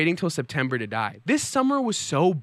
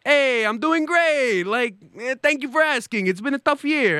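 overjoyed festival-goer, laid on thick; cheery Italian; 9 semitones higher than usual. Retold or imitated. Imitating cheerful Italian reply.

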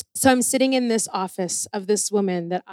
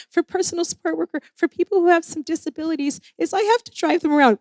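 higher, fast, eager pitch to the official; laying it on thick; 6 semitones higher, much faster than usual. Retold or imitated. Pitching her excuse to keep the license, laying it on thick. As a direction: rush the excuse, buttering her up. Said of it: "and I was laying it on thick".

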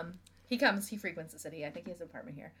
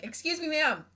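higher, louder call to a passerby; 5 semitones higher, 6 dB louder than usual. Retold or imitated. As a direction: holler it out the car window, re-enacted. Re-enacts shouting from car at passersby.

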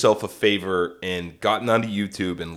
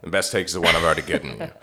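Orson Welles impression; 8 semitones lower, 3 dB quieter, much faster than usual. Repeats as Welles, lower.

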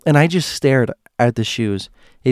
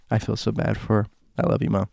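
usual speaking voice

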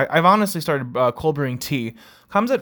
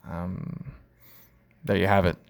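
normal talking voice

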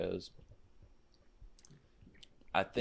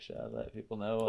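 ordinary talk

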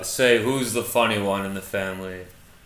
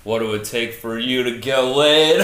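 normal talking voice

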